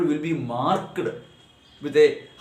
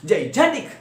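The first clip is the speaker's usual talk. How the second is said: "Gigantic" said as one word, raised on "gan"; stress on second syllable; 4 semitones higher than usual. Shown as a demonstration. Teacher repeats how it should be pronounced, stressing "gan" in "Gigantic". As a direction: punch the middle syllable of "Gigantic". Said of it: "we give more stress".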